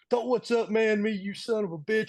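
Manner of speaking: high-pitched mock rant, pitch way up; retold furious rant, pitched high and fast; angry rant. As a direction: play the furious coworker yelling down the phone. Angry coworker chewing him out.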